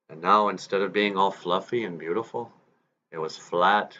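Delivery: ordinary delivery, nothing marked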